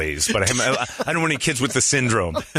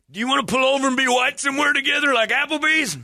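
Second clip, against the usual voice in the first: very high, fast mock voice; squeaky hammed-up date offer; mock gun guy. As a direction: rush the eager invitation. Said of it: "mock gun guy".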